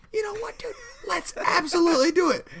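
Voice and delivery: very high squeaky enthusiasm; mock eager self. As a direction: imitate yourself gung-ho in a high voice. Retells own eager reply in silly voice.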